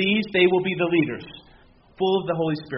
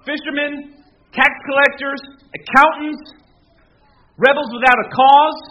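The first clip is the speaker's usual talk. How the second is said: loud, raised pulpit voice, building; full-force preaching; 4 semitones higher, 6 dB louder than usual. Acted. Pastor to congregation on the apostles, loud preaching. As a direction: preach it out with rising fire.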